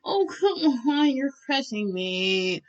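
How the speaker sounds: slow, drawn-out whine starting high; whiny complaint. A narrator's reading. Crushed listener protesting to Bakugo, whiny. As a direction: drag out the protest, whining.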